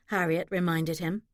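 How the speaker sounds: ordinary delivery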